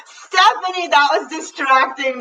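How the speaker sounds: high-pitched, laughing mock reproach; amused scolding. Real, not acted. Coach teasing a participant who distracted her, amused scolding. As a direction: chide someone through your laughter, pitch sliding down.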